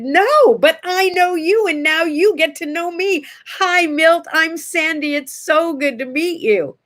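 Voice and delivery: high, eager greeting; gushing introduction. Retold or imitated. Fan introducing herself to Milton Berle, gushing.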